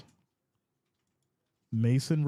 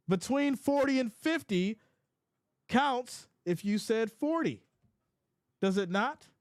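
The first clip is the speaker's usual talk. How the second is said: high mock protest; 9 semitones higher than usual. Retorting to Becky's challenge.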